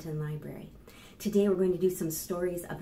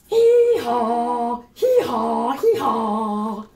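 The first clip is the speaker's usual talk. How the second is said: loud silly hee-haw braying; loud donkey hee-haw; 10 dB louder, much slower than usual. Pretend. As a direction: bray like a happy donkey. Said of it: "A donkey hello is silly and happy, like this".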